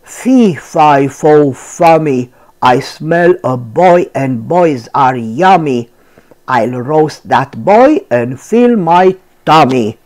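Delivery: lightly voiced giant, loud and menacing; low mean giant. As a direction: rumble it like a hungry giant. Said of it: "in a low, mean voice".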